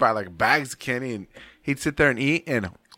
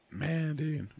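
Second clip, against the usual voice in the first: voice cracking; 5 semitones higher, 7 dB quieter than usual. Real, not acted. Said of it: "Man, my voice just cracked".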